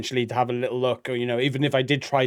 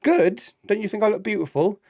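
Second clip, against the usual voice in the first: indignant wife; 7 semitones higher than usual. Acted. Wife challenging husband's faint praise, mock indignant. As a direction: pitch it up, prompting for a compliment. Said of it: "what should the woman do".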